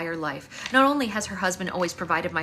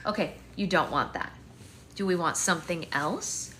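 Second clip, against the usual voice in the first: high singsong soothing, louder at first; 8 semitones higher, 4 dB louder than usual. Real, not acted. Mother soothing fussing baby over mango vanilla, high singsong.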